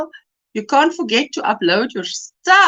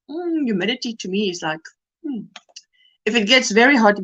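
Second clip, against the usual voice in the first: ordinary talk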